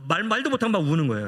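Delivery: usual speaking voice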